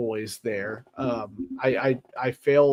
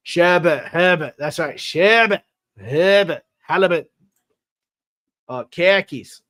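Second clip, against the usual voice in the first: Shabbat, habit and halibut said higher and louder in a Boston accent; clipped Boston vowels on each word; Bostonian accent; 4 semitones higher, 5 dB louder than usual. Shown as a demonstration. Streamer listing Bostonian words in a Boston accent. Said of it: "All the Bostonian things to say".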